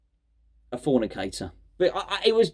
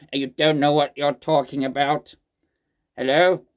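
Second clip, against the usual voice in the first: robot voice; 4 semitones lower than usual. Retold or imitated. Vlogger replaying the prank call for viewers, in a lightly robotic low drone. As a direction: intone it like a stiff machine reading the prank message. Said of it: "I don't know why I'm doing Peter Crouch".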